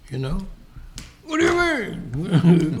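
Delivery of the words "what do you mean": higher, drawn-out mock indignation; hammed-up touchy comeback; mock affronted reaction. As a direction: play put out, stretching it. Acts listener's reaction to tiredness question.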